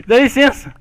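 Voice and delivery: bossy, loud telling-off; scolding. Pretend. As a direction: push in with insistent complaints. Scolding a player over a mistake.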